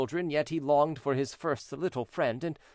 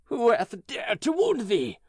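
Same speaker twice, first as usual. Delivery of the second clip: higher, louder, slow outcry; angry cry; 7 semitones higher, 5 dB louder, slower than usual. Acted. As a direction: cry it out in outrage. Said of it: "cried the giant".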